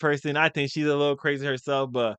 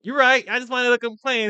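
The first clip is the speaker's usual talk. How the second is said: sheepish agreement; 9 semitones higher, 4 dB louder than usual. Retold or imitated. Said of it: "I'm like".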